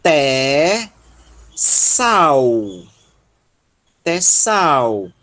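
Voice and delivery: "Té" and "sal" drawn out, "sal" gliding as "sau"; separated, stretched "Té, sal" with an AU glide; syllable-by-syllable model. Shown as a demonstration. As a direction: sound out "Té, sal" slowly, syllable by syllable. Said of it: "vamos pronunciar esse ditongo au".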